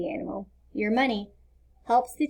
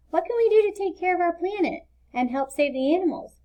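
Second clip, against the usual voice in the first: put-on eager small boy, higher pitch; young boy Leo; 7 semitones higher than usual. A narrator's reading. Leo asking Mister Green how to help, eager child voice. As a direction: ask the teacher like a keen little boy.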